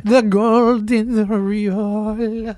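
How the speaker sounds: put-on slow, drawn-out Italian-accented English; Pope Francis accent. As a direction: stretch it out like the Pope in broken English. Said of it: "this adorable little broken English".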